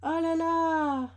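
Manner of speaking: drawn out, very slow, amazed; awed oh là là. Real, not acted. Admiring her rare card, a slow amazed exclamation. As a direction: stretch it out in wonder.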